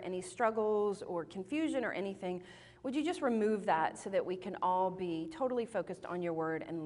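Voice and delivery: soft, low, subdued praying voice; sincere prayer. Real, not acted. Speaker praying for women gathered today, soft and sincere. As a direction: pray quietly and earnestly, voice settled low.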